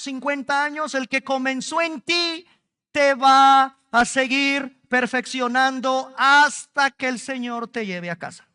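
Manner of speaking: ordinary delivery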